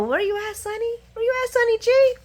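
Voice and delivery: high singsong mock search call; playful calling out. Fan pretends to look for Sonny, playful high call. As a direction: call out teasingly, pitch way up.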